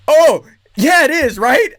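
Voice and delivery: high, loud gushing yes; delighted agreement. Retold or imitated. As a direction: gush with delight to the buyer. To buyer, delighted.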